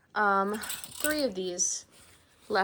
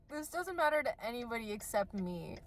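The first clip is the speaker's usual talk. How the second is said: usual speaking voice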